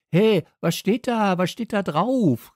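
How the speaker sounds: high, loud, insistent demand; calling out. A narrator's reading. Dog demands to hear the note, calling out. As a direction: shout it, pressing for an answer. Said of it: "rief ich".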